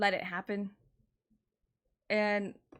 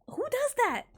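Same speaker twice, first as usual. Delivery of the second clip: much higher, louder, disbelieving; exasperated squeal; 4 dB louder than usual. Real, not acted. Ranting to viewers about his reaction, exasperated high squeal. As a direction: screech the question in disbelief.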